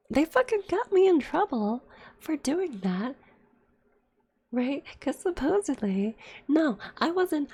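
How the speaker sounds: real indignation boiling over; heated. Real, not acted. Streamer venting to chat about being blamed, heated. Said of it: "Sorry, I got a little heated there".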